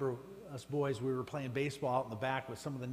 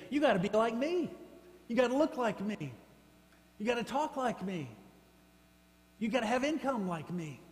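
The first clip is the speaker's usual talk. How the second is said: mocking quote; 7 semitones higher than usual. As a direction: play the preachy gatekeeper. Mocking demanding church people.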